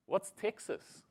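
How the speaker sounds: put-on small, high, soft Kiwi kid voice; little Kiwi boy. Little Kiwi boy asking his new mate, small puzzled voice. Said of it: "the little Kiwi boy goes".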